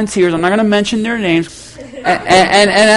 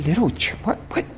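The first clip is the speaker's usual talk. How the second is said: stunned disbelief; 13 dB quieter than usual. Retold or imitated. Pastor repeats her insult, stunned. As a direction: echo "Little church" under your breath, baffled.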